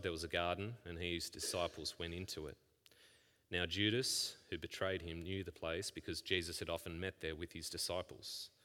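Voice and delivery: soft, even Bible reading; hushed scripture reading. Acted. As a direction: read the passage quietly to the congregation. Reads the Bible passage aloud.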